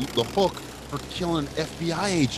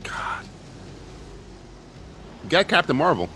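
ordinary talk

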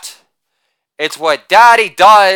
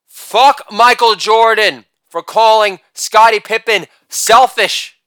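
usual speaking voice

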